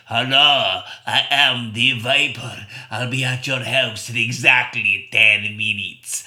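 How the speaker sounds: low, slow sinister caller; creepy phone voice. Retold or imitated. Mystery caller threatening on the phone, low slow sinister voice. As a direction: menace the boy over the phone, low and slow. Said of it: "there was a voice that said".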